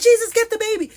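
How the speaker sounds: high, loud imitated shriek; panicked cry. Women in the back yard as a child is about to fall, panicked shout. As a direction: mimic alarmed women crying out, shooting up high. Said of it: "They were just like".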